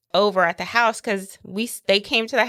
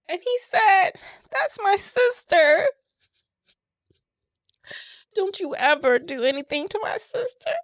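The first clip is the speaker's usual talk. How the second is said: tearful; 6 semitones higher than usual. Real, not acted. Tearful retelling after crying.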